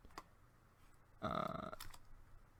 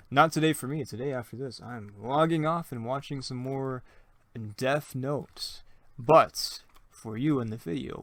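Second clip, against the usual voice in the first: ordinary talk, nothing marked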